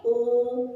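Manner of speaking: ओ said clearly with rounded lips; a clear, rounded ओ held for the class; model vowel. Shown as a demonstration. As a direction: show the class how ओ sounds, mouth rounded. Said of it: "इसको उच्चारित कैसे करते हैं".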